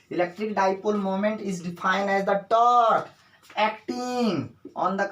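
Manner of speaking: slow, drawn-out definition for the class; measured classroom dictation, word by word. Shown as a demonstration. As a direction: dictate it slowly, leaning on the key terms.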